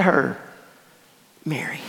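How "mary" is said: tender, hushed name call. Acted. Jesus gently calls weeping Mary by her name, hushed and tender. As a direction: say her name softly, much quieter, like a gentle recognition.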